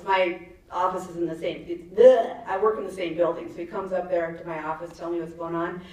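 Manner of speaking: ordinary delivery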